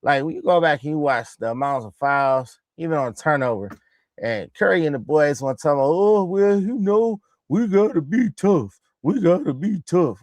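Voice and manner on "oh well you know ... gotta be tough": drawn-out, slightly higher mocking imitation; mock whining quote. Mocking Curry and the boys' postgame talk, mock whine. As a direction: mimic them in a mildly whiny sing-song.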